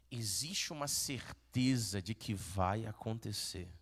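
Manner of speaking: calm pastoral certainty; low earnest assurance. Acted. Preacher drops to conviction.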